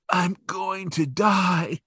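hammed-up dying man crying out; mock dying wail. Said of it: "And Muntz is like".

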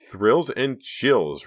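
sarcastic. A narrator's reading. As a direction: drag it out with eye-rolling sarcasm. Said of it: "Reva said sarcastically".

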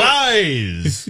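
Arise with a trilled R; rolled R. Retold or imitated. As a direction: roll the R in Arise. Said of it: "It is fun to roll your R's".

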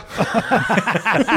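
bursts into a high, delighted laugh. Real, not acted. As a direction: laughs at his own bold call. Laughs at his own prediction.

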